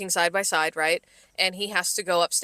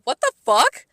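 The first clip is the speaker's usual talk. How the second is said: a louder, higher retold "what the fuck"; shocked exclamation; 5 semitones higher, 6 dB louder than usual. Coworker retelling her stunned reaction to a rude comment, shocked. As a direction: blurt it out as if caught off guard by a rude coworker. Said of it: "and I go".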